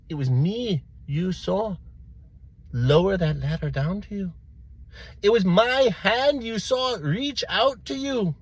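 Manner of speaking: rising, loud, drawn-out proclamation; younger priest boasting. Acted. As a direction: build slowly to a high, grand claim. Younger priest tops the first dream.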